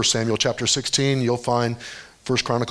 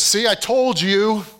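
slow, high sarcastic jab; mock sarcastic gloat; 9 semitones higher, 4 dB louder, slower than usual. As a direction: sneer it like a told-you-so. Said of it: "'cause he was mocking".

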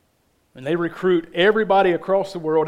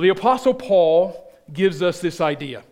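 ordinary delivery

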